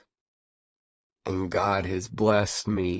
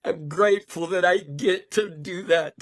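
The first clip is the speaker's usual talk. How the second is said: high, tearful voice breaking with joy; crying happy tears while speaking; 9 semitones higher, 3 dB louder than usual. Real, not acted. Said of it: "I know I'm crying, guys, but these are happy tears".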